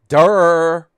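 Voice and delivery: loud, high, drawn-out dopey "durr"; mocking duh. Commentator ridiculing the rider for leaving the bike on, mock-stupid. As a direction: jeer at an obvious blunder. Mocks rider's obvious mistake.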